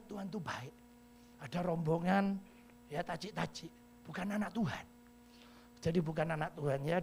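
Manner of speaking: normal talking voice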